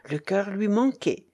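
usual speaking voice

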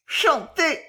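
high, anguished cry; râle d'horreur. A narrator's reading. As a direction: gasp it out in horror. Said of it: "râla-t-elle". (cri aigu d'angoisse)

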